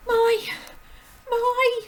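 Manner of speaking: put-on high, loud girlish "My, my"; Matilda's voice by mistake; squeaky high "My, my" in the wrong character. Shown as a demonstration. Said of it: "I did that the wrong way round".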